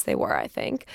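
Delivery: a quick whispered aside, half to herself. Real, not acted. Guest whispering a joke about her parents. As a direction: drop to a breathy whisper for the joke.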